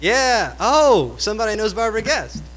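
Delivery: high, loud, delighted exclamation; thrilled someone gets the reference; excited. Real, not acted. Delighted someone recognizes poet.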